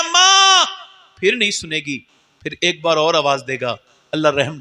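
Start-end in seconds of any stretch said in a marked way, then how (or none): 0.0-0.6 high, loud cry of "माँ"; calling out. Acted. Son crying out to his mother from judgment, loud. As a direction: call to her in desperation.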